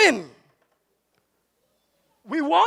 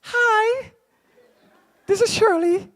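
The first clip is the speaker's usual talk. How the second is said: disguised phone voice, pitched up; light falsetto disguise; put-on higher voice; 6 semitones higher, slower than usual. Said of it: "we will change your voice".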